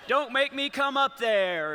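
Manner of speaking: slow, a touch of a stern father; stern dad threat. Retold or imitated. A hint of a stern father warning his kids, slow.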